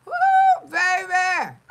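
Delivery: high, loud whoop through laughter. Real, not acted. Laughing off the haters with a loud high whoop. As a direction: whoop it out, cracking up.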